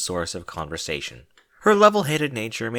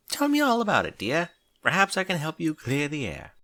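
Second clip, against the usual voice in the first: higher, gently reassuring; a hint of a caring refined friend; lightly voiced Rarity; 7 semitones higher than usual. A narrator's reading. Rarity comforting Twilight.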